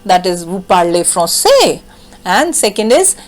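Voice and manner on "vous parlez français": "Vous parlez français" as a question, pitch jumping up on "français"; question intonation. Shown as a demonstration. Teacher recapping the first form, "français" lifted questioningly. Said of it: "our first form is intonation".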